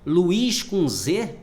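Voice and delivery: Luiz com Z said slowly and loud, rising; Luiz com Z stressed. Shown as a demonstration. Teacher to class, Luiz com Z stressed slow and loud.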